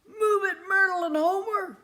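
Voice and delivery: yelled. Acted. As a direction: shout the horses into motion. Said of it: "yelled Jacob".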